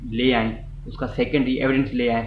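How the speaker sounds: ordinary delivery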